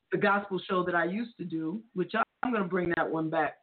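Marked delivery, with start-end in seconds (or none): none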